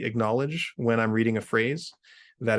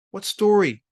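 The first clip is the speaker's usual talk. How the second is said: higher, louder demand; shout; 9 semitones higher, 6 dB louder than usual. A narrator's reading. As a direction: shout the question across. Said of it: "shouted Gauntlet".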